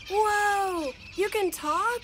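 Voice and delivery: high, loud astonished gasp; shocked that it talks; surprised. Acted. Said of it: "said a surprised Matt".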